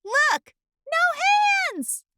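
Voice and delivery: high gleeful shout; gleeful boast. Acted. Acorn rolling downhill, showing off gleefully. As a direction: show off mid-roll.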